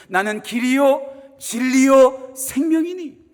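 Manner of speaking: slow, loud declaration of the verse; solemn pulpit proclamation; proclaimed scripture. Acted. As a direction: ring out each phrase.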